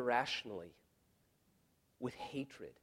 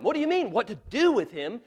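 loud, high impassioned preaching; preaching at full force; 10 semitones higher, 13 dB louder than usual. Acted. Pastor pleading Pilate's case to the congregation, loud and impassioned. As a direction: thunder it from the pulpit.

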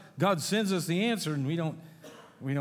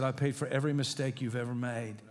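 soft God voice; 4 semitones lower, 5 dB quieter than usual. Acted. God speaking softly.

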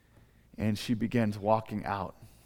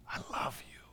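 whispered love; 13 dB quieter than usual. Acted. Father welcomes the forgiven child.

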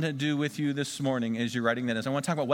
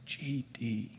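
hushed taboo word; 6 semitones lower, 9 dB quieter than usual. Shown as a demonstration. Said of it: "Don't say".

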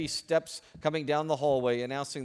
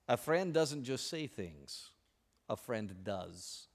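slow, soft, sincere; 4 semitones lower, 6 dB quieter, much slower than usual. Acted. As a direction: let the moral land gently. Quoted moral, slowing.

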